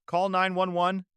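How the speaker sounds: lightly voiced yell from a bystander; mild yell. A narrator's reading. Party guest calls for emergency help, mild yell. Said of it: "Someone yells".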